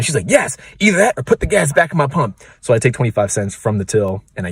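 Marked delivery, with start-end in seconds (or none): 0.3-2.4 high-pitched mock demand; demanding customer. Angry customer answering the clerk's offer, indignantly demanding. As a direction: imitate an indignant customer insisting. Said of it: "she's freaking out".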